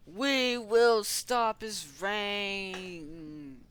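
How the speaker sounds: loud, very drawn-out high declaration; heroic vow. Pretend. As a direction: proclaim it grandly, stretching the words. Heroes vow defiance in play.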